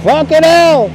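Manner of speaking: egging the truck driver on from the sidewalk; cheering on. Real, not acted.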